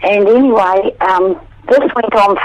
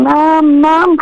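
higher child's plea, lightly voiced; children calling; 7 semitones higher than usual. Kids by the bed calling their mother, higher. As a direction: lightly imitate kids crying for mum.

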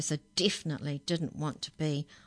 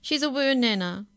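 high, louder tearful wail; frightened girl sobbing; 9 semitones higher, 6 dB louder than usual. A narrator's reading. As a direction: plead through tears. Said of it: "Stella Rose sobbed".

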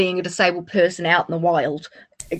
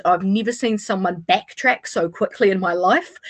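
ordinary talk, nothing marked

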